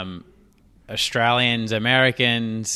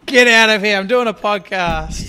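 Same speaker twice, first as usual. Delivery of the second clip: high, loud mock shoo-away; mock shout; 10 semitones higher, 10 dB louder than usual. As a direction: shout at the family to get out. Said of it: "It's like".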